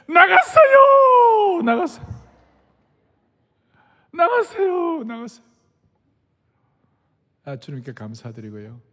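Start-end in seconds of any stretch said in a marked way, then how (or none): 0.1-5.4 high, loud, drawn-out joyful cry; tearful shout of relief; ecstatic shout. Retold or imitated. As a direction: shout the news, overjoyed. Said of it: "쫓아온 거예요".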